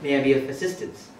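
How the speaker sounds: put-on polite Indian-accented call-centre agent. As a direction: answer like an Indian customer care operator. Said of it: "switch from Indian to English accent".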